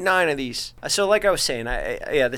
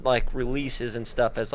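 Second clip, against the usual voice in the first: normal talking voice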